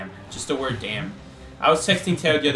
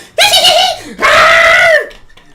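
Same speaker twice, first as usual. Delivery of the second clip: clown impression; 12 dB louder than usual. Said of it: "that's also a Clown impression".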